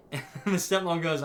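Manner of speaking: ordinary talk, nothing marked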